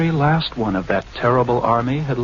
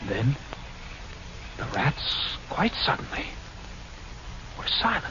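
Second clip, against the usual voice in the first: hushed whisper; 13 dB quieter than usual. Acted. Rats fall silent in dark.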